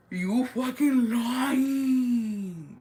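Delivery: big gasp, then high drawn-out disbelief; dramatic gossip shock, wailed slowly; acting surprised. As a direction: stretch the words in hammed-up shock. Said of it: "me acting surprised".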